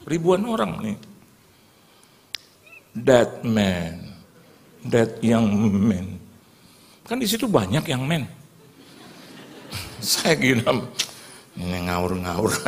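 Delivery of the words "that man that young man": low, stretched-out words, imitating a preacher; single someone out slowly and deliberately; slow drawn-out calling. Preacher picking a young man from the crowd, slow drawn-out call.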